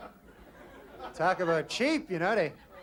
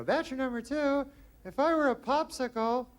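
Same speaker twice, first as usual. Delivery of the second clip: higher-pitched put-on girl voice; dating game girl; 9 semitones higher than usual. Girl questioning bachelor number two from a card, put-on girl voice. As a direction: play the girl contestant, pitched up high. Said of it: "Girl gotta go".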